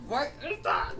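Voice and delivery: high-pitched squeal of disbelief; shocked. Acted. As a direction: blurt it high, as if stunned. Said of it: "'cause he's shocked".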